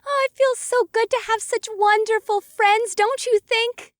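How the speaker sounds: high, bright gushing; bubbly excitement. A narrator's reading. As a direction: gush about new friends. Galmine bubbly after cheering.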